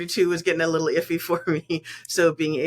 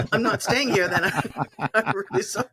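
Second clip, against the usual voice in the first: laughing; 5 semitones higher than usual. Retold or imitated. Laughing while refusing to stay.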